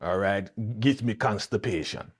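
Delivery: rhyming, hammed-up Jamaican patois; mock Jamaican accent. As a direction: parody a bad Jamaican accent, drawing out each rhyme. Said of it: "Jamaican accent".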